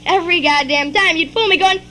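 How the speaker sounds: ordinary talk, nothing marked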